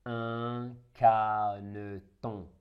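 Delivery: slow, split: un ca-ne-ton; syllable by syllable. Shown as a demonstration. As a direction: break caneton into syllables slowly. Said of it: "Après le découpage".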